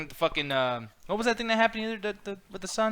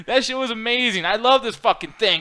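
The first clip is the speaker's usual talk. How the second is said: ordinary delivery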